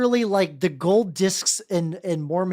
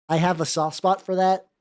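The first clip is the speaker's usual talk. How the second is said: normal talking voice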